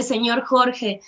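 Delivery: usual speaking voice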